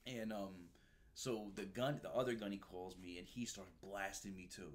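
ordinary talk, nothing marked

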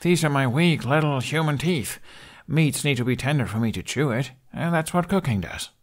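a lad poking fun at his own teeth; Hiccup voice. Acted.

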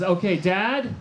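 mock barista shouting a name to a room; barista calling out. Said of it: "the guy calls out".

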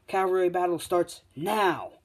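high, loud, drawn-out start announcement; announcer's call. Retold or imitated. Announcer starting the cavalry battle, loud rousing call. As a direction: call the start, stretching the last word.

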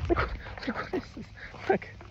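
high, soft puzzled squeak; high, hushed wonder; squeaky stoned wonder. Pretend. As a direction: marvel at your own jerky moves. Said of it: "you think you're moving robotically".

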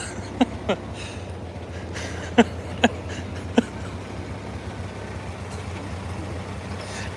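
silent, airless wheeze of laughter; breathless wheezing laugh. Real, not acted. Laughing helplessly at the truck flying by. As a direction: laugh until no voice comes out.

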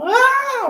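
high mock baby squeal; baby's startled cry. Preacher mimics a baby splashed at baptism, high squeal. Imitating baby sprinkled with water.

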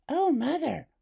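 put-on girl amazed at her mother; Hazel. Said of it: "said Hazel".

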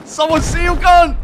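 high frantic yell; panicked shouting for help; being chased. Real, not acted. Frantic base defence shouting.

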